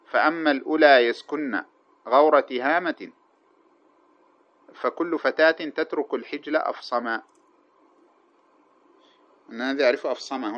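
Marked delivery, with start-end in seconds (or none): 0.1-7.2 slow, measured poetry recitation; chanted classical verse, slow and deliberate; reciting verse. Shown as a demonstration. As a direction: draw out each line of the old poem. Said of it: "كقوله".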